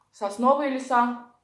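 talking between bites; chewing while talking. Real, not acted. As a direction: mouth partly full. Seen 4: She holds a dark piece, bites it at 5.5, then chews while talking.

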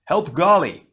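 high, loud shouted order; alarmed battle shout; urgent yelp. A narrator's reading. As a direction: yell for him to help. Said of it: "Pohatu yelped".

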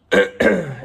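lautes, kräftiges Räuspern. Real, not acted. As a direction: sich laut die Kehle freiräuspern. Sick vlogger clearing throat. (erkältete Vloggerin räuspert sich vor dem Abschied)

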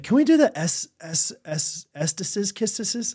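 dad mimicking his young son; little boy imitation. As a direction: fumble for the word like a small kid. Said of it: "And so he's like".

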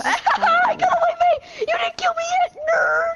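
squealing high anime hero gloat; overacted anime dub. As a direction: shriek it like a dubbed anime hero. Escapes enemy, anime-style gloat.

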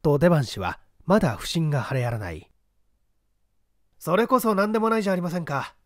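a lightly voiced, unhurried gentleman sleuth; composed gentleman detective. The detective calmly directing the man up the ladder.

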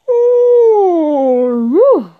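big, loud vocal yawn; high yawn gliding down, then a "woo"; real yawn. Real, not acted. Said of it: "I can't stop yawning".

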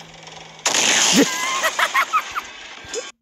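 long, high-pitched burst of laughter; helpless high laughing fit; laughing hard. Real, not acted. Cracking up at the game.

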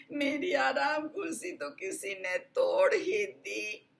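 a slow, tearful little bear voice; crying baby bear. Acted. Baby bear crying over his broken chair. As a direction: whimper it out like a sad cub. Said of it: "नन्हे भालू ने रोते हुए कहा".

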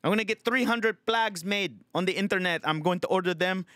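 put-on high-pitched, indignant Filipino accent; livid Filipino tenant. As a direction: protest like a fed-up Filipino tenant. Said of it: "Filipino tenant that's fucking just livid".

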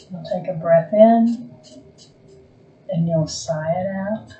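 slow, calm meditation voice with long pauses; guided meditation. Acted. Yoga teacher guiding a resting class into Yoga Nidra, calm guided meditation. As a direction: ease them gently toward stillness, phrase by phrase.

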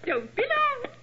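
high, sweetly coaxing; coy call. Acted. Lady coaxing the doctor not to linger, coy. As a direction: flirt as he lingers behind. Flirting lady calls after Watson.

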